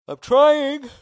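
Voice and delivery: words stretched through a yawn. Real, not acted. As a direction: yawn while reading it. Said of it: "Sorry, I'm yawning here".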